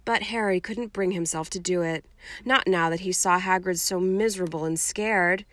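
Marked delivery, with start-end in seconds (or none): none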